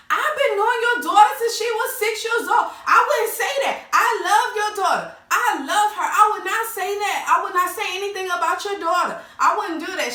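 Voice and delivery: mock indignant denial. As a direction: play up the offended friend swearing she'd never. Jen denies it in the quarrel.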